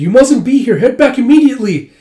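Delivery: high, loud, urgent order; alarmed warning. Acted. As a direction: send someone out of danger. Acted warning in burning building.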